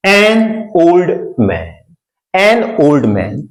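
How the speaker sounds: put-on an old man drawn out slowly; teacher spelling out an old man; an linked onto old, slowly; slow model reading. Shown as a demonstration. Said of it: "teacher modeling phrase".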